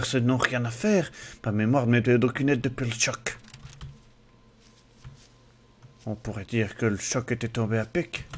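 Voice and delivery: lightly put-on Belgian accent, reading the game text; a hint of a Belgian accent in a mock narrator voice. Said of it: "Désolé de faire ton accent". (léger accent belge imité en lisant le texte)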